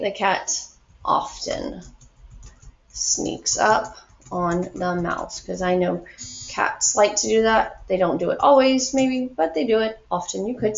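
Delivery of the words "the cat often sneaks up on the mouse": slow model sentence. Shown as a demonstration. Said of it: "I'm gonna say".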